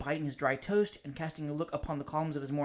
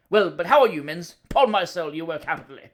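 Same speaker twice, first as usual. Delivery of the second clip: hearty greeting, much louder; top of his voice; 10 dB louder than usual. Acted. Cousin bursts in on the bachelor, booming at full voice. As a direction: boom the greeting across the room. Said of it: "He always spoke at the top of his voice".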